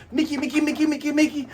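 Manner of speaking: high, fast repeated squeak; squeaky chant. Retold or imitated. Luffy's attack done as a high squeaky chant. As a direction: rattle it off like a cartoon mouse. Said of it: "Luffy's like".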